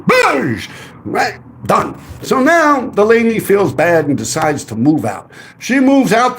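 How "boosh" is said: high, punchy mock crash noise; playful boom for a wipeout; crash sound effect. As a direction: slam it out like a bike hitting the ground. Mimics a motorcycle crash.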